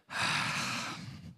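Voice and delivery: soft breathy sigh, air only; relieved exhale; long unvoiced sigh of relief. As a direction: let it all out in a sigh. David's relief after cover-up.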